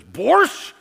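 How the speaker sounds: curt questioning "Borscht?" with a rising tune; scowling offer. Retold or imitated. Stewardess scowls, offering soup to the preacher: curt "Borscht?". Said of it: "she's just got this scowl".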